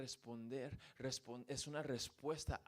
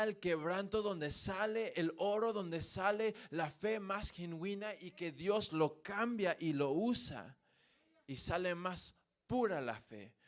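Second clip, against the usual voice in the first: higher, louder preaching at full force; fervent preaching; 4 semitones higher, 4 dB louder than usual. Acted. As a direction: build the fire of the sermon. Preacher rising to a climax.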